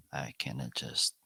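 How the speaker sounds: usual speaking voice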